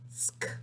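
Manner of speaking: "sk" said alone and very softly; isolated onset "sk" for children; beginning sound isolated. Shown as a demonstration. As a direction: give just the hushed "sk" onset. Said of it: "tell me the beginning sound".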